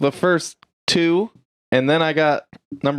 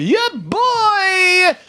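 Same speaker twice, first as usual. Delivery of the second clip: shouted catchphrase. Acted. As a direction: belt the catchphrase back. Show catchphrase shouted back.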